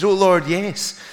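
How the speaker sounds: high, loud acted declaration of faith; desperate avowal. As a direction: swear it fervently to your rescuer. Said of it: "the guy, still hanging on".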